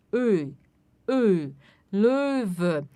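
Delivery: "Ö" with pursed lips while saying e; rounded "Ö". Shown as a demonstration. Teacher demonstrating umlaut sound to students. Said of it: "fazer biquinho e falar E".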